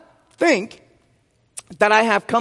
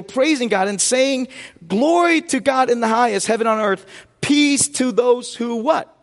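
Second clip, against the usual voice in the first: raised, ringing preacher voice, calling for answers; full-force preaching; 5 semitones higher than usual. Acted. Pastor preaching the Christmas scripture to his church, full force. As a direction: preach it out to the church and draw the responses.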